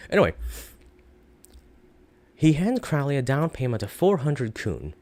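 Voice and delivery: usual speaking voice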